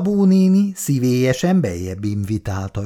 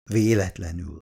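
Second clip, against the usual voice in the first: low, softer sly aside; slightly mocking; 5 semitones lower, 5 dB quieter than usual. A narrator's reading. Irén advising on chance meetings, slightly mocking. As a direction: drop the word with faint irony. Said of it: "Irén hangjában egy kis gunyorosság".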